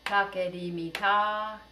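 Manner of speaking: "Take di mi ta" slow and even; slow rhythm. Shown as a demonstration. Teacher calls the rhythm slowly for echoing. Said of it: "rhythms in a really slow tempo".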